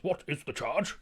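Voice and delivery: high, swooping mock indignation; theatrical protester. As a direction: parody a dramatic orator's grand questions. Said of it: "He goes".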